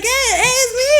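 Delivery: retold calling out. Calling to little sister from the car, high and insistent.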